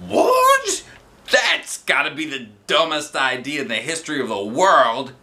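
very high, loud shocked cry; shocked outburst. A narrator's reading. Teacher shocked by the shark plan, high outburst. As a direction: gasp it out in disbelief.

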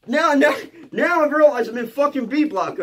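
loud, high alarmed realisation; hammed-up rising dread, pitched high; acted panic. Storyteller relives realising where he is locked up, panicking. Reenacting dawning panic after threat.

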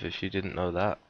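ordinary delivery

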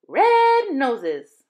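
loud, bright clowning cry; playful clown call. A narrator's reading. Ling Sung answering Terry with a clown face, loud playful call. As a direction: shout it like a clown.

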